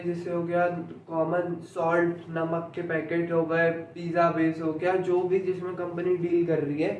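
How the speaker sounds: ordinary delivery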